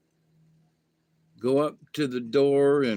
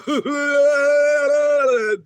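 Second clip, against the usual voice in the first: high burst of laughter; 12 semitones higher, 7 dB louder than usual. Acted. After the boo.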